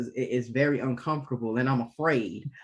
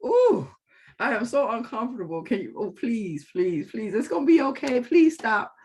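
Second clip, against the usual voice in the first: mock uncomfortable pleading; 8 semitones higher, 4 dB louder than usual. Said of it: "It's about".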